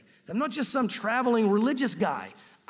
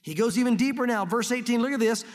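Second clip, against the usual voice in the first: ordinary delivery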